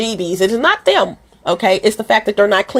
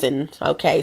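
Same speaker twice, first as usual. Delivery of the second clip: usual speaking voice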